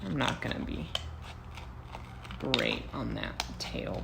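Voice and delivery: ordinary talk, nothing marked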